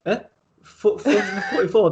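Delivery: high, loud puzzled repeat; mock-confused customer checking the number; baffled query. Acts confused customer asking back.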